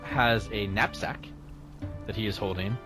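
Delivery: ordinary delivery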